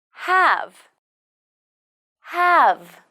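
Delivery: "Have." falling, ending on an audible buzzing v; final V sounded. Shown as a demonstration. Teacher models the final sound, clear V ending. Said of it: "Pay attention to the final V sound".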